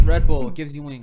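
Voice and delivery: loud ad-voice slogan; mock ad read. Joking fake sponsor plug, loud ad voice. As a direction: sell the slogan like a commercial.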